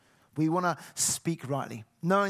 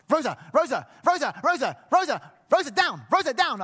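higher, loud calls, rising; calling the dog; 9 semitones higher, 6 dB louder than usual. Retold or imitated. Owner calling his jumping dog off guests, loud. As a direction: call the dog down urgently.

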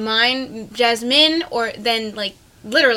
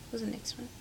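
whispering; 18 dB quieter, faster than usual. Real, not acted. Whispering to herself, trying to recall.

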